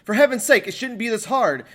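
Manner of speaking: normal talking voice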